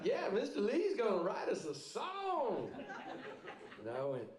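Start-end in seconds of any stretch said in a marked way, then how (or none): 0.0-2.7 put-on high, eager cheering of little kids, a bit soft; mock kid cheer, higher and softer; excited little boys. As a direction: play the thrilled Cub Scouts cheering. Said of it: "started going like".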